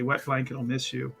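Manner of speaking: usual speaking voice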